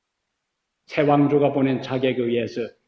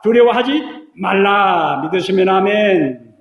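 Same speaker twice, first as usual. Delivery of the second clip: loud, raised preacher's proclamation; preaching; 6 semitones higher, 7 dB louder than usual. Acted. Pastor calling the congregation to amen, fervent.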